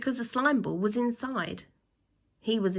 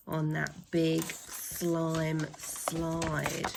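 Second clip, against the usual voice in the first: slow; 4 semitones lower, 3 dB quieter, much slower than usual. Acted. Recapping the slide escape.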